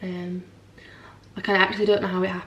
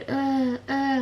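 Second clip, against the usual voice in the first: imitated baby fussing; 6 semitones higher than usual. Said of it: "He's just, like, going".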